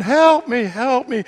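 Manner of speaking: high, pleading little voice; a small creature calling for help; tiny frog voice. Acted. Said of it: "he hears the voice again".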